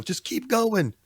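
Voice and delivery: high, pushy encouragement; high urging. Pretend. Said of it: "[name]'s gonna go".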